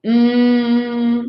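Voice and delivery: put-on isolated m sound for a child. Shown as a demonstration. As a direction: hum "mm" as a long closed-lip m. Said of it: "y este es".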